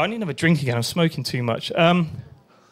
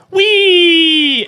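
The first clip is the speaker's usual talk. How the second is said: gleeful wee; 10 semitones higher, 7 dB louder than usual. Retold or imitated. Mimicking joy sliding downhill.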